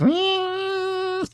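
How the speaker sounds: thin, very high sustained whine; imitated demon whine. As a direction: hold a soft, spooky high-pitched "eee". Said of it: "spooky high-pitched noises".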